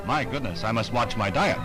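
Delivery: normal talking voice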